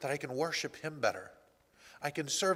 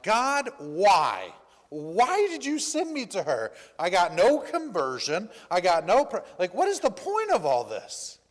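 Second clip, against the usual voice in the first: retold upset; 2 semitones higher, 5 dB louder than usual. Upset helper questioning God in the car, exasperated. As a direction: re-enact crying out in frustration to God. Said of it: "and I'm upset".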